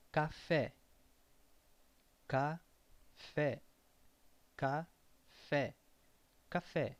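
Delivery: Café with the last syllable fé stressed and open. Shown as a demonstration. Teacher models accent-marked stress for learners, fé stressed. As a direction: hit fé in Café. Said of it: "With acento agudo".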